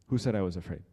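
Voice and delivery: loud acted challenge; fearless retort. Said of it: "he's fearless".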